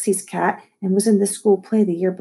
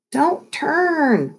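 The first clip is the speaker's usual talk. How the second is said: higher-pitched cry of warning; alarmed call; 9 semitones higher than usual. A narrator's reading. Kid to runaway dog on skateboard, alarmed. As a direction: call out as the skateboard goes wrong. Calling out during skateboard wipeouts.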